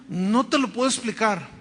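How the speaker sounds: usual speaking voice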